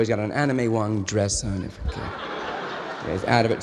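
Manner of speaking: usual speaking voice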